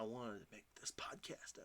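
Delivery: breathy unvoiced whisper; whispered, sleep-deprived aside. Real, not acted. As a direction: hush it like a tired confession.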